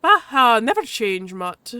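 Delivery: loud teasing start in Irish tones; vaguely Irish accent. Acted. Badger teases the mutt, vaguely Irish. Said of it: "the badger's vaguely Irish".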